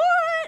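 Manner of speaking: bright burst of laughter at herself; high laugh. Real, not acted. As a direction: laugh it off, amused. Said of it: "I think I just fell a little in love with you".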